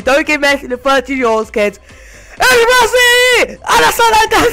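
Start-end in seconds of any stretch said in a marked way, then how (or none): 2.4-4.5 high-pitched jubilant shout; excited Spanish-style yelling. Real, not acted. Player celebrating Messi in a pack, excited yelling. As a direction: yell it out high and wild. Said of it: "I don't know why I'm yelling Spanish word".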